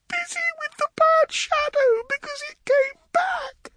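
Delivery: put-on very high tearful little-girl voice; crying child. A narrator's reading. Upset girl answering the woman comforting her, crying. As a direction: whine it through tears like a small child. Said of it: "Yonsei was crying loudly".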